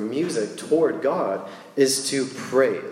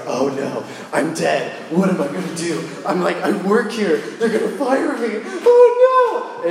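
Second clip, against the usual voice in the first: mock panic; 4 semitones higher, 4 dB louder than usual. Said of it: "I was like".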